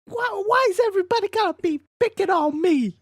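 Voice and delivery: very high, loud whiny rocker; [name] voice. Acted. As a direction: whine it like an upset rock singer. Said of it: "a better [name] voice".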